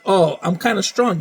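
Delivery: normal talking voice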